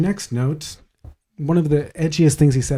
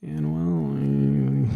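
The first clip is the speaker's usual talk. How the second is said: low, drawn-out mock hesitation; slow, deep, hesitant put-on voice; meek comedian impression; 7 semitones lower than usual. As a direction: imitate a sheepish, reluctant reply. Mimics the comedian's meek reply.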